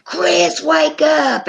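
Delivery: half-hearted imitation of screaming someone awake; retold scream. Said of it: "grabbed Chris and started screaming".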